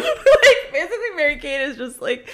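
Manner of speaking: high, laughing explanation. Real, not acted. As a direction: talk through the giggles.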